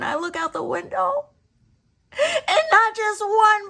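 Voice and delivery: high, loud retelling building to a shriek; excited yelling. Retold or imitated. Brother reporting hummingbirds after months, building excited yell. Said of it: "he screams and he yells".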